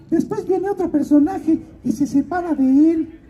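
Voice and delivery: high-pitched godmother character voice. Retold or imitated. Godmother explaining the next character to her godson, high put-on voice. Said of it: "Y me dice".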